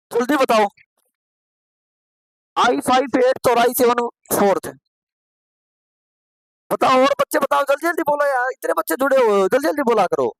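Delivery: ज़ोर से पुकारते हुए; चुप बच्चों को जल्दी जवाब देने को उकसाना; urging loudly. Real, not acted. Teacher urging silent online students. (Teacher class से ज़ोर देकर जवाब माँगते हुए)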